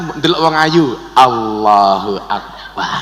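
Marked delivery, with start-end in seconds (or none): 1.1-3.0 slow, low, drawn-out admiring takbir; pleasing intonation. Shown as a demonstration. Preacher admiring a pretty person, slow pleasing takbir. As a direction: croon it pleasantly, long and low. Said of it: "intonasinya dibuat menyenangkan".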